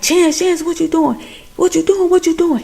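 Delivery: high, rapid-fire retold protest; mock alarm. Michael to Chance as he gets attacked, mock alarm. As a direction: act out startled pleading, pitched well up.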